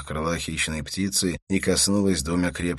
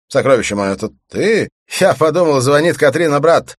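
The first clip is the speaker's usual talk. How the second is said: loud, rising, caught off guard; flustered surprise; 10 semitones higher, 12 dB louder than usual. Acted. Husband caught out by wife's call, loudly flustered. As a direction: blurt it out high and loud. Startled husband, still shouting on phone.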